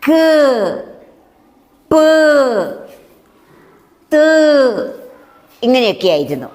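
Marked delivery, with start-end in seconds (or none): 0.1-5.0 short bare consonants with no vowel; clipped consonant sounds. Shown as a demonstration. Teacher showing the children bare consonant sounds. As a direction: clip each consonant off with no vowel after. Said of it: "വ്യഞ്ജനാക്ഷരങ്ങൾ ഉണ്ടായപ്പോൾ".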